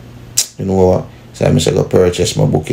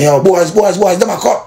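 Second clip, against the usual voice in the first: higher, urgent mock call to the crew; lookout's call-out; 12 semitones higher than usual. Lookout warning the crew at the gully, a higher urgent call. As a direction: call out the warning that they're coming.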